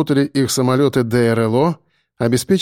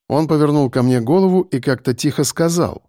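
ordinary delivery, nothing marked

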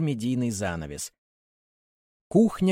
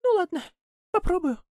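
sigh. Acted. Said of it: "Буратино вздыхает".

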